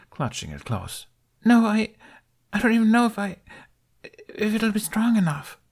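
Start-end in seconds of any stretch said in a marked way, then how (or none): 1.5-5.6 put-on high, halting child voice, words catching; a frightened boy tripping over his words; stuttering boy. A narrator's reading. A scared young boy stammering his doubt to Jack. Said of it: "Jack's heart caught at the stutter".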